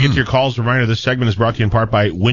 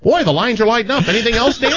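higher, sing-song hint, laughing a little; mock passive-aggressive; 10 semitones higher than usual. Host imitating himself hinting at Dan to answer phones, sugary. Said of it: "Whereas I'll just say things like".

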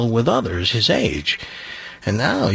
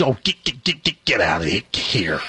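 stuttering disbelief. Acted. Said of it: "stuttering problem".

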